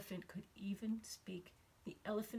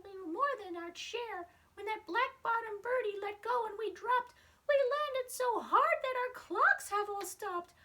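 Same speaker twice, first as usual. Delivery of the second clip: much higher, small far-off voice; tiny Who voice; 12 semitones higher, 6 dB louder than usual. Acted. The mayor of Whoville thanking Horton, tiny high voice. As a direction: squeak it up like someone no bigger than a speck. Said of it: "came the voice of the mayor".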